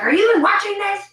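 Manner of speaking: high, loud annoyed question; annoyed husband. Retold or imitated. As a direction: snap it at her, fed up. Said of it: "He's like".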